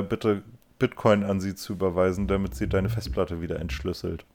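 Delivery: ordinary talk, nothing marked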